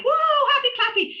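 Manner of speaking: a squealing send-up of fake positivity; mock over-the-top excitement. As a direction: parody a gushing happy-clappy type. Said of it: "That doesn't mean like".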